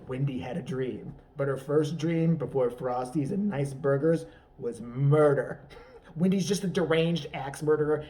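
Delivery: ordinary delivery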